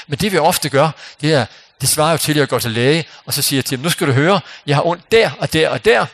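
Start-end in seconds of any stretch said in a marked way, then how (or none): 3.8-6.1 louder, higher patient listing complaints; lightly acted, insistent patient; mock demanding patient. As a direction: reel off aches to the doctor, emphatically. Acting patient rattling off complaints to doctor.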